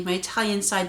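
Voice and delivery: ordinary delivery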